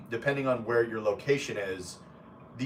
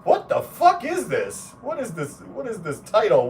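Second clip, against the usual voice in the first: exasperated mock reaction; 9 semitones higher, 4 dB louder than usual. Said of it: "multiple people that I'll be like".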